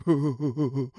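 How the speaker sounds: low mock chuckle; imitated laughing. Doug in the front seat laughing at the fight, low mock chuckle. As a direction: imitate a friend's hooting snicker.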